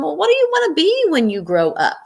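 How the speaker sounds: high, bright showman asking the crowd; cheery magician. Acted. Magician asking the children what they want to be, cheery showman. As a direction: ask it like an excited entertainer.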